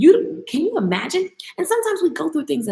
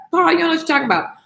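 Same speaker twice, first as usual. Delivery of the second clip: quick, higher-pitched mock retort; dismissive crew; 4 semitones higher, much faster than usual. Crew brushes off the prisoner's warning, quick mock dismissal. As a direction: rattle it off, imitating sailors brushing someone off. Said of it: "So they was like".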